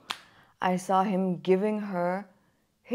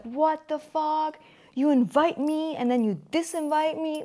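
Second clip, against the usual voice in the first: angry; 7 semitones higher than usual. Retold or imitated. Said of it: "I was so pissed at him".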